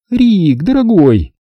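loud woman's greeting. A narrator's reading. Zelda hails Rick across the terrace, loud high voice. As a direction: call out to a dear friend, high and bright. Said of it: "громко сказала она".

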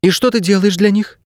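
high, inquiring heroine voice; curious girl. A narrator's reading. Girl asking the dog about his job, curious. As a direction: ask high, probing for details.

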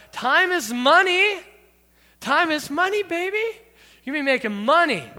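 high, hammed-up hustler cheer; mock-excited money talk; playful hype. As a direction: crow it high with a rising swing. Hams up the familiar phrase.

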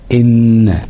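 إن drawn out through the nose on ن; held ghunna. Shown as a demonstration. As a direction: lean long on the ن in إن. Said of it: "هنتك على النون شوية". (مدّ غنة النون في إن)

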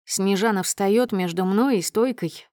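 ordinary delivery

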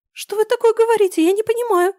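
high squeaky panicked protest; squeaky alarm. Acted. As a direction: shriek out a flustered denial.

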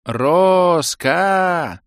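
loud desperate call; yelling. A narrator's reading. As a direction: shout it out into the distance. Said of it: "снова крикнул".

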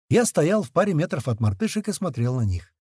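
plain reading voice

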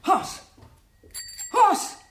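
high, loud cries of the name; alarmed shout. Acted. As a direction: call out to wake the still body. Shaking the unconscious man.

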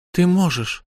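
wife mocking her husband in a high teasing voice; Lyu mocking. A narrator's reading. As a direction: needle him with high false indignation. Lyu retorts.

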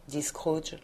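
usual speaking voice

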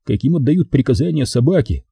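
usual speaking voice

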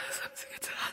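horrified breathless whisper; shocked whisper. Acted. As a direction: whisper it in horror. Entsetzt nach dem Sturz. (entsetztes Flüstern)

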